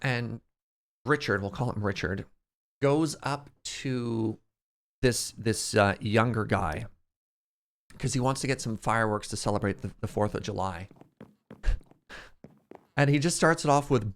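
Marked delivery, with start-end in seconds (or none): none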